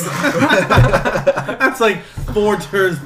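slow, high, laughing. Acted. Joking gaming equals tours, slow laughing. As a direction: stretch it out through laughter.

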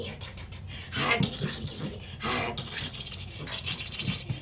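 hushed, breathy rapid chatter; soft, quick repeated coaxing; teasing the dog. Real, not acted. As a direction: whisper excitedly to tempt a dog. Luring dog to chase toy.

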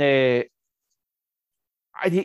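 normal talking voice

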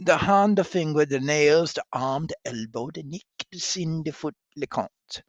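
loud, proud word list recital; halting phrasebook tourist. Acted. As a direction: rattle off the words proudly. Catherine recites the full list.

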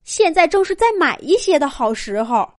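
father mouse talking himself into it; eager father mouse. Acted. Father mouse's eager quoted speech.

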